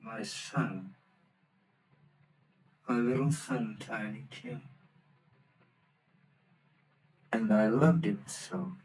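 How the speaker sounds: very soft, broken mourning; father's quiet grief. Acted. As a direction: barely hold back the tears. Bob grieves for Tiny Tim.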